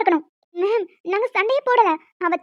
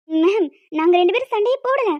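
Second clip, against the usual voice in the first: normal talking voice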